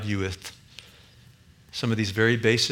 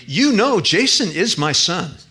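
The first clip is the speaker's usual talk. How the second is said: much higher and louder, slow on Jason is my son; passionate conviction; 7 semitones higher, 6 dB louder than usual. Retold or imitated. Dying Phil telling the author Jason is his son, passionately. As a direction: declare it with fervent conviction. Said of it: "with a passionate conviction".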